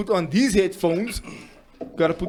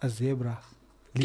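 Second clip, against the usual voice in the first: whispering; 6 semitones lower, 7 dB quieter than usual. Shown as a demonstration. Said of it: "I'm going to whisper it into the mic".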